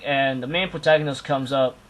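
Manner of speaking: normal talking voice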